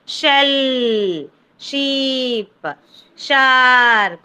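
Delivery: "Shell", "Sheep", "Shark" each drawn out and falling; phonics word demo. Shown as a demonstration. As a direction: hold the opening sh and vowel of "Shell", "Sheep", "Shark". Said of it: "you can hear the sh sound".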